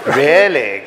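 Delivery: acted delight with a touch of giggle; giggly. A narrator's reading. Princess delighted by Nicholas's gossip, lightly giggling. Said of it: "giggled the princess".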